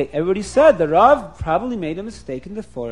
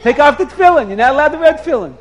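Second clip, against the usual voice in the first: loud, higher-pitched scolding; mock scream of alarm; retold screaming; 4 semitones higher, 5 dB louder than usual. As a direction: shout the prohibition like an outraged rabbi. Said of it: "he starts screaming".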